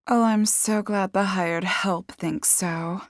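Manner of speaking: slow, drawn-out sarcasm; sarcastic retort. A narrator's reading. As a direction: put down the help with mock gratitude. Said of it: "Madison retorted".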